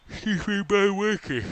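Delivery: said through a yawn. Real, not acted. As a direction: yawn mid-word. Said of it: "Don't know why I'm yawning so much".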